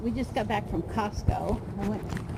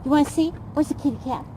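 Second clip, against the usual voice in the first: high sing-song coaxing of a dog; talking to a pet; 5 semitones higher, 3 dB louder than usual. Real, not acted. Calling the dog to look.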